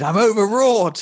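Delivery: high, loud, slow overwrought declaiming; milk every syllable like a ham actor; hammy stage actor. Acted. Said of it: "I'm overacting".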